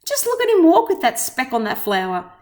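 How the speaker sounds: a lightly voiced jeering neighbor; jungle gossip. A narrator's reading. Jungle animals mocking Horton, lightly voiced jeer. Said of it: "the news quickly spread".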